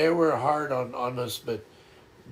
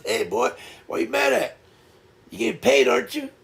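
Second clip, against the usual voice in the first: high, playful imitation of dad teasing; 11 semitones higher, 5 dB louder, faster than usual. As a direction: mimic a father ribbing his sulking son, pitched up. Dad teasing son, voiced in story.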